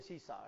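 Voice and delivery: "सीसा" with a plain s in both syllables; no sh anywhere in "सीसा"; seesaw pronunciation. Shown as a demonstration. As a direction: say "सीसा" as see-saw. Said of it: "अंग्रेजी में इसको बोलते हैं".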